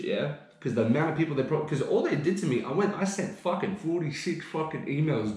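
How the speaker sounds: normal talking voice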